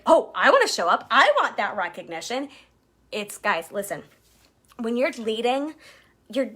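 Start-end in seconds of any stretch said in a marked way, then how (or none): 0.0-2.5 louder, eager imitated teammate voice. Team member wanting recognition, eager. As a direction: play the eager newcomer wanting praise.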